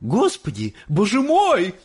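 high, loud shriek of alarm. A narrator's reading. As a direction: cry out as a horrified mother. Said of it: "вдруг завизжала миссис Боригард".

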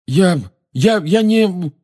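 high, panicky false starts; frightened stammer. A narrator's reading. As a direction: stammer a denial, pitched right up. Frightened Harry stammers to Snape.

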